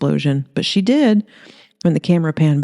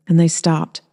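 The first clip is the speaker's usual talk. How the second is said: plain reading voice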